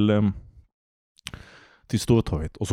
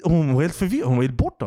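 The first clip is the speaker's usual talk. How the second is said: normal talking voice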